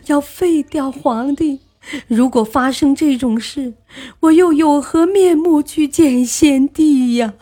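theatrical crying lament; loud tearful wailing. A narrator's reading. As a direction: wail it out through performed sobs. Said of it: "放声大哭".

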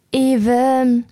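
even sung with a long ee and a clear v. Shown as a demonstration. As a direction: hold the E of even, then sound the V. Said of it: "要把E和V读出来".

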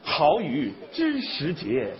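slow, soft reciting voice; poem recitation. Shown as a demonstration. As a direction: recite slowly and gently. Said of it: "老师跟你对诗".

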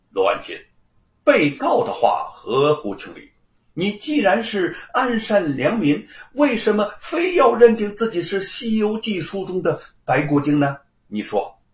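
loud, pressing courtroom question; interrogating magistrate. Acted. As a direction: bear down on him like a judge, firmly. (官老爷大声逼问)

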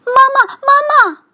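high-pitched, loud cry for mum; a little bear crying out for mama; calling out loudly. A narrator's reading. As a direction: shout it high and urgent. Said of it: "大喊".

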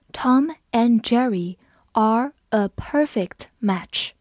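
slow, even, every word clear; slow read. Shown as a demonstration. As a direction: read it slowly for learners. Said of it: "我们慢读一遍". (慢速清晰朗读)

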